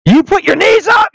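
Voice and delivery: mock yell of an outraged wrestler; imitating Jericho. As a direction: yelp it out high, as if calling out an opponent mid-match. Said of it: "audibly yelling him".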